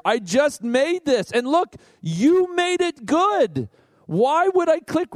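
smug self-satisfaction. Retold or imitated. Voicing self-satisfied believer.

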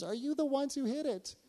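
high-pitched mock-timid question; imitating tearful girl. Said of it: "she's crying".

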